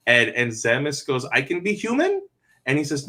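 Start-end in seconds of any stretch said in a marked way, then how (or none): 1.3-2.2 put-on high, rising childlike question; small high voice, lifting at the end; child's hopeful question. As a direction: ask it upward like a hopeful child. Voices hopeful child in retold scene.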